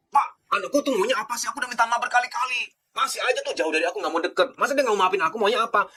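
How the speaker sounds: frustrated parent. Retold or imitated. Parent complaining to the counsellor that the child won't forgive, exasperated.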